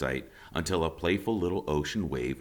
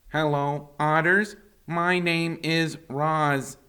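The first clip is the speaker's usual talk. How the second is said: robot voice; 9 semitones higher, 4 dB louder than usual. A narrator's reading. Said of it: "The robot's voice was simply too much".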